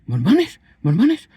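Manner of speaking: a pastor recoiling in horror at the word, rising shriek; shocked disbelief. Retold or imitated. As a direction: repeat the word in growing outraged disbelief. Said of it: "le cambió la cara completamente".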